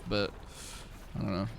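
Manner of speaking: ordinary talk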